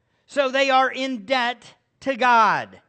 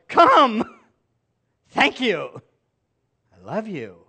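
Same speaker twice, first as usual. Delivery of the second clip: welcoming father; 5 dB louder, slower than usual. Retold or imitated. Father welcoming a repentant child.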